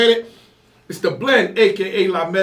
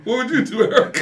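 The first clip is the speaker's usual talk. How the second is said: laughing; 7 semitones higher, 5 dB louder, faster than usual. Real, not acted. Laughing question.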